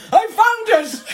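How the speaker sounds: high, loud, excited shout. Retold or imitated. Irish bar owner running out of the kitchen, shouting. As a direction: yell it like you just found the missing whisk. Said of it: "came running out ... was like".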